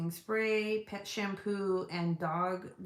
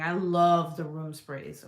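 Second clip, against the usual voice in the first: "love" drawn out and louder; stress on love; 3 semitones lower, 5 dB louder, slower than usual. Real, not acted. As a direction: lean hard and long on "love". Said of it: "Emphasis on love".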